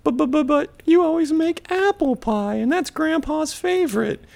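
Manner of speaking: put-on high falsetto child voice; mock dismayed kids. Kids questioning Grandma's cherry pie, mock falsetto dismay. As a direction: stammer it high like a put-out kid. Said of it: "the kids are like".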